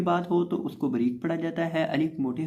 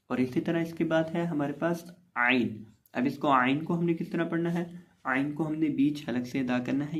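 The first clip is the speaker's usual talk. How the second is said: usual speaking voice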